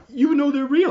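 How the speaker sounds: high, loud mock-tearful question; imitating crying woman. Crying woman asking him for confirmation, imitated high and loud. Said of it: "she was just crying".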